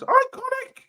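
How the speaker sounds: very high squealed "Iconic!"; squealed catchphrase. Retold or imitated. Streamer squealing the Iconics' catchphrase at chat, high and gleeful.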